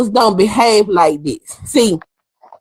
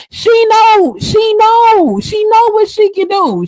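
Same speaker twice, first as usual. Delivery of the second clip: emphatic; 10 semitones higher, slower than usual. Real, not acted. Vlogger insisting Layla knows better, high. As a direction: hammer the point, pitched up.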